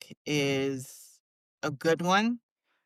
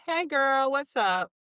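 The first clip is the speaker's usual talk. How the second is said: bright, higher put-on cheer answering the phone; pretending all is fine for a friend calling; fake cheerful greeting; 10 semitones higher, slower than usual. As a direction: hide the tears and sound chipper.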